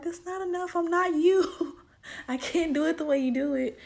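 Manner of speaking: I can't do it the way you do; exasperated inner voice. Retold or imitated. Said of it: "In my mind, I'm like".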